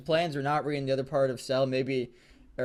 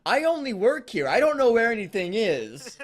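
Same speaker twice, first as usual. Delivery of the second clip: high clueless employee; 8 semitones higher, 4 dB louder than usual. Acted.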